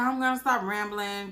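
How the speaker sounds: ordinary talk, nothing marked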